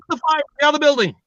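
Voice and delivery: high, fast evacuation call; playfully urgent alarm, rushed and high; mock fire warning.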